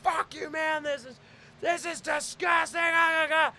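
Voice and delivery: high, strained indignant ranting; acted high-pitched fury without much volume; mad outburst. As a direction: rant in disgust, breaking into wordless cries. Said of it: "I get mad".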